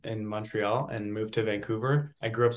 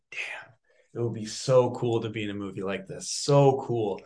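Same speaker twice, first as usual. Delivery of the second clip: whispered, dreamy awe; awed whisper; 2 semitones lower than usual. Retold or imitated. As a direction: breathe it out in wonder. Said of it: "and I said".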